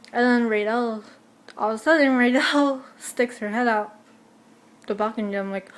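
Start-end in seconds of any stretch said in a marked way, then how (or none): none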